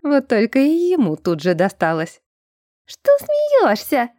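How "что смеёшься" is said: very high, slow, squeaky drawl; spiteful falsetto taunt; nasty squeaky woman. A narrator's reading. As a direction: draw it out nastily in a squeak. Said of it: "гаденько протянул писклявый женский голос".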